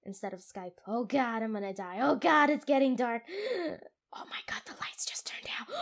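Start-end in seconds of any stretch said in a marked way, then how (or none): 3.3-5.7 hushed frightened whisper; scared whisper. Real, not acted. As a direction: whisper it in the dark. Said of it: "I am so scared".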